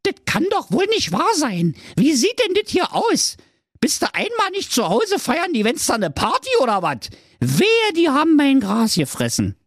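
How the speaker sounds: high shrill Berlin mum; indignant high mother voice; nagging mother goat. A narrator's reading. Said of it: "Die Geiß dachte".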